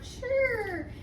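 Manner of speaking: bright high sure; chirpy sure. Retold or imitated. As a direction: sing it out cheerfully. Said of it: "I'm like".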